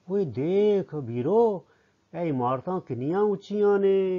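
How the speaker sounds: high, slow calling-out in wonder; amazed. Shown as a demonstration. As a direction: draw someone's eyes to a marvel. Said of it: "ਇਹ ਗੱਲ ਹੈਰਾਨੀ ਨਾਲ ਕਹਿ ਰਿਹਾ ਹੈ".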